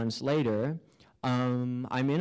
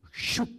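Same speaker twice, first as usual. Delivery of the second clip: short voiceless "shoop" whoosh; whoosh sound effect. Acted. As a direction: make a quick sucked-away whoosh noise. Imitating whoosh out of body.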